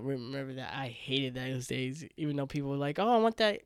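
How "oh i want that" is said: higher, louder imitation of keen onlookers; mock admirer. People admiring the speaker's blonde hair, mock eager.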